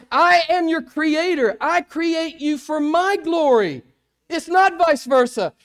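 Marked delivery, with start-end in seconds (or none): 0.1-3.8 slow, higher, louder divine declaration; voicing God. Preacher voicing God the creator, slow and loud. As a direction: decree it, weighty and unhurried. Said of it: "then he goes on to say".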